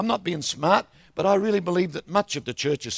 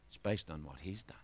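much lower and much softer; hushed emphasis; 12 semitones lower, 18 dB quieter than usual. Real, not acted. Preacher tells the congregation the key line, hushed. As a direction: drop to a near hush.

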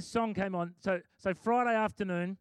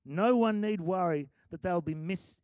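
normal talking voice

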